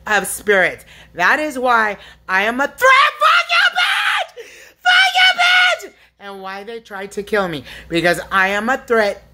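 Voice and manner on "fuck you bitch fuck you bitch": raw screaming outburst. Real, not acted. Lashing out at unseen tormentors, shrieking. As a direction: shriek the curse out at full force. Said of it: "This yelling and screaming".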